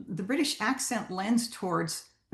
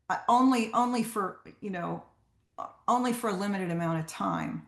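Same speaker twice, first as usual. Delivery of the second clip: ordinary talk, nothing marked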